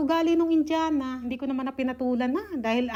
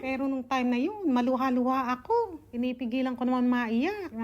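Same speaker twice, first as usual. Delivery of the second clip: ordinary delivery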